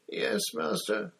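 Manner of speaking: high, slow servile moan; pained moan. A narrator's reading. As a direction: moan it in agony to your master. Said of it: "moaned Wormtail".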